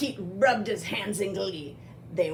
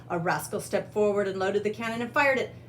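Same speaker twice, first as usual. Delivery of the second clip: ordinary delivery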